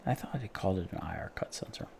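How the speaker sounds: muttered under breath; whispered aside. Real, not acted. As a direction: mutter it to yourself.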